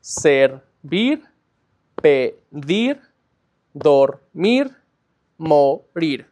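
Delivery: each verb cut into two spaced syllables, the first punched; split into syllables. Shown as a demonstration. Teacher showing two-syllable verbs, syllable by syllable. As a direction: sound out "Servir, pedir, dormir, morir" in two beats each. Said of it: "with two syllables".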